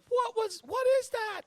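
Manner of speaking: high, loud falsetto alarm; panicked question. Acted. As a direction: cry out in acted panic. GM voices frightened Abby.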